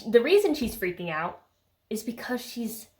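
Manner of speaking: ordinary talk, nothing marked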